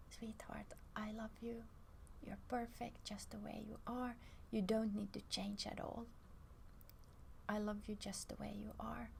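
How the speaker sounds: soft, breathy whispered reassurance to the body; tender whisper to yourself. Shown as a demonstration. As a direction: whisper it like comforting a loved one. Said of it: "you speak like that to yourselves".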